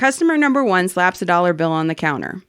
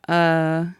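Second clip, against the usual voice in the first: unsure; 3 semitones lower than usual. Retold or imitated. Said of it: "Like it's a question".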